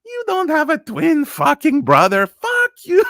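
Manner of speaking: very high, hammed-up outraged screech; telenovela fury. Lover rejecting the twin excuse, mock high fury. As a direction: explode as a betrayed soap-opera lover. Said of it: "telenovelas on Spanish, uh, T V".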